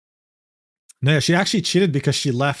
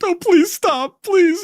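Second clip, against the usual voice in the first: very high, slow mock begging; performative pleading; 4 dB louder, much slower than usual. Streamer mocks man begging her to stop, performative pleading. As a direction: beg in a drawn-out, hammed-up wail. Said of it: "God, he's so performative".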